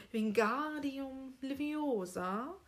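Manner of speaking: Wingardium Leviosa very slowly, GAR and O held long; deliberate, stretched Wingardium Leviosa; correctly intoned spell. Acted. Hermione wants the teacher's attention, correctly drawn-out Wingardium Leviosa. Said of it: "dann sagt sie's eben richtig".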